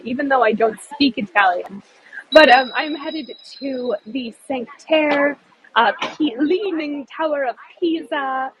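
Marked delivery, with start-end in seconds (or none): none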